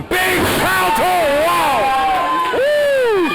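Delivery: high excited shouts; excited yell. Real, not acted. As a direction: call the big move, then whoop. Commentator reacting to big move.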